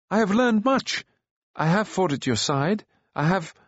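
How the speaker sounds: high, eager protest; excited pleading. A narrator's reading. Taran argues he has earned his place, excited. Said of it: "interrupted excitedly".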